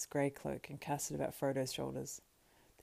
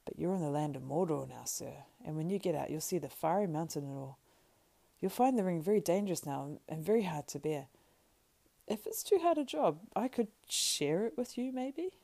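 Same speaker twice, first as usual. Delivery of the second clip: gentle, loyal Sam; 4 dB louder than usual. Acted. Sam offers to share Frodo's burden, cautious and loyal.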